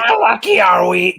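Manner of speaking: high, loud celebrity impression; Gilbert Gottfried. Attempting a hard comedian's voice, high loud mimicry. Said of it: "he's a hard one to do".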